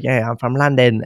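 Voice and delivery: put-on a quick touch of London accent; imitated London accent. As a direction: lightly mimic a working London accent. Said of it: "straight out of London and sounded like".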